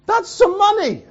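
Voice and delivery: very high, loud outburst of amazement; amazed exclamation. Real, not acted. Amazed at Saudi prince's extravagance.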